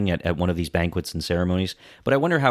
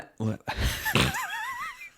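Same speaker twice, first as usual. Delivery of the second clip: a sudden high-pitched laugh at his own tongue slip; high burst of laughter; 6 dB quieter than usual. Real, not acted. Laughing at his own flub mid-discussion, high burst. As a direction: laugh helplessly, high and breathy.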